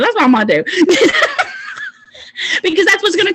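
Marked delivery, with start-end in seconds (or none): 0.7-1.9 a squeal of laughter, much higher. Real, not acted. About messaging sad people, high laugh.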